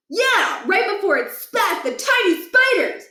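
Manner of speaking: put-on high, rushing boyish voice full of wonder; a kid breathlessly telling about a video game; excited kid Stuart. Stuart gushing to friends about a game boss, high and excited.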